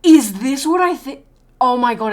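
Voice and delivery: loud, high burst of astonishment; excited disbelief. Real, not acted. Startled reaction on stream.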